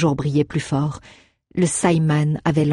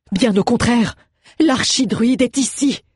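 crying out; 7 semitones higher than usual. A narrator's reading. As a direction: cry it out, pointing him out. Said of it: "s'écria Béli".

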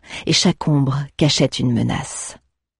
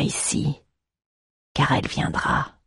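ominous whisper; 7 dB quieter than usual. A narrator's reading. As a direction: whisper it like a dark omen. Ominous prophecy by the master.

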